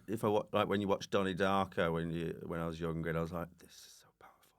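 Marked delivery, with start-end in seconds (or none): 3.6-4.5 barely audible, breathy whisper; under the breath, deeply impressed; hushed awe. Retold or imitated. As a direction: whisper it like an awestruck young viewer. Acts out his awed younger self.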